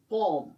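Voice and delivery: पॉम with no L sound; silent L. Shown as a demonstration. Teacher models the word, L silent in पॉम. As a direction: say पॉम, leaving the L out. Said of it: "इसमें एल silent रहता है".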